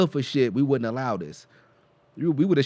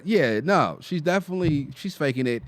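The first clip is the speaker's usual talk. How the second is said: ordinary talk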